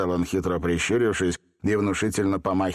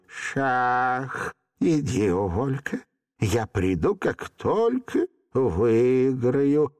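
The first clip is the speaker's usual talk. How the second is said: slow, drawn-out character voice; unhurried old Hottabych; 2 semitones higher, much slower than usual. A narrator's reading. Hottabych sending Volka away until he wins, sedate. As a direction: dismiss him absently, lingering on the words.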